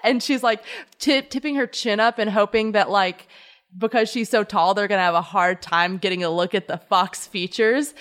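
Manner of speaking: normal talking voice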